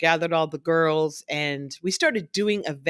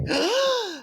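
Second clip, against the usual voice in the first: high, far-off gasp of shock; amazed gasp. Retold or imitated. Girls gasping at the salary, high and shocked. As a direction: gasp like a stunned kid. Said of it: "They're like".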